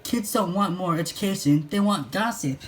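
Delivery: put-on high, fast teasing girl voice; mock him cheerfully; Cindy teasing Jimmy, high and merry. A narrator's reading. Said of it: "Cindy said merrily".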